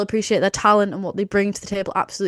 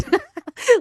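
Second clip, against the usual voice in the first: high laugh; amused laugh mid rant. Real, not acted. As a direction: burst out laughing.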